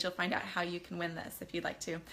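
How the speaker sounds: plain reading voice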